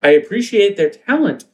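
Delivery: put-on high dazed teen girl. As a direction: wake up high and bewildered. Teen girls waking up.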